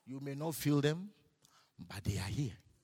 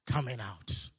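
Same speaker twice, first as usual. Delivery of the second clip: usual speaking voice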